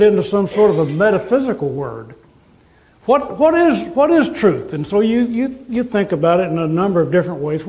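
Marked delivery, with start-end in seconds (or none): 3.0-4.7 raised, searching ask of the big question. Acted. Preacher posing the age-old question to the congregation, raised and searching. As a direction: lift the voice high and puzzle over it.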